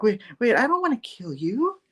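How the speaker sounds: high-pitched voice of a gentle aunt; sweet aunt. Retold or imitated. Sweet aunt coming to her senses, high bewildered voice. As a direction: play a sweet, bewildered older relative.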